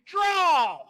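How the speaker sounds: put-on a loud high gunfight call to the kids; shouted draw. Shown as a demonstration. Announcer to kids at the arena, loud showdown shout. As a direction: bark it out like a showdown starter. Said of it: "And then I'm gonna say".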